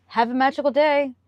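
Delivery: a high, sugary "Have a magical day" with a falling "day"; mock magical day. Cast member sweetly brushing off a rude guest, high and sugary. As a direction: sweeten it over a bite of contempt. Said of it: "and they say".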